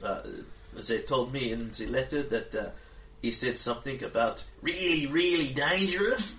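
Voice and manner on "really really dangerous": put-on Australian accent. GM sliding into an Australian accent for the adventurer. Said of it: "And new accent emerges".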